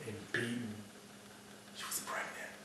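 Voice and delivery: whispered in shock; hushed whisper. Acted. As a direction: breathe it out, barely voiced. Finding the dead girl.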